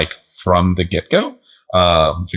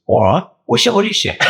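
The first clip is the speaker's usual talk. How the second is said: comic British go-to line, pitched up; British accent like Jason Statham; 12 semitones higher than usual. Acted. Grappler showing off his go-to line, mock British accent. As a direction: put on a British tough-guy accent for a laugh. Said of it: "It sounded like Jason Statham a little bit".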